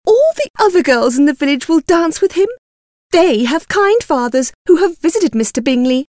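high, fretful, cross complaint. A narrator's reading. Wife blaming husband for daughters' lost dances, cross. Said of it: "looked at Mister Bennet crossly".

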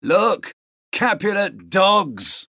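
jeering call in a put-on voice; mock shout. A narrator's reading. Servants spotting their rivals across the square, restrained shout. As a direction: call out the insult, slowly drawn out. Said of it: "the voices shouted".

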